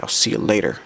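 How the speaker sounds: understated, subdued goodbye. Acted. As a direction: toss back the farewell with little energy. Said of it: "And then D J is like".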